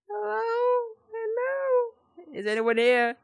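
high, thin nervous hello; nervous calling out. Real, not acted. Player calling into an empty dark house, high nervous hello. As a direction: call into the dark, high and scared.

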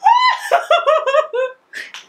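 high, loud burst of laughter; overjoyed laughing shriek. Real, not acted. Vlogger seeing the new laptop, high laughing shriek. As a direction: shriek with laughter, overwhelmed with joy.

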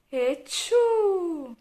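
big, high "achoo"; high drawn-out mock sneeze; acted sneeze. A narrator's reading. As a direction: let the sneeze burst out and ring. Character sneezes in library.

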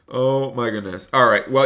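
usual speaking voice

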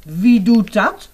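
loud, slow startled question. Acted. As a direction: jump and blurt it out. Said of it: "Maar dan opeens schrikt hij".

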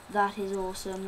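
normal talking voice

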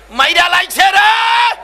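high, loud, drawn-out yell; screaming. Acted. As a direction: cry out in the dark as if being killed. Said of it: "fuller মা তো চিল্লাইতেছে অন্ধকারে". (উঁচু গলায় টেনে চিৎকার)

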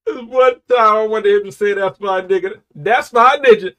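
high, loud excited yelling; hyped shout. Real, not acted. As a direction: shout it out, fired up by the verse. Hyped reacting to a rap lyric.

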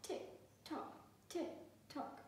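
whispered tick tock; clock ticking. A narrator's reading. Living room clock at night, whispered ticking. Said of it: "went the living room clock".

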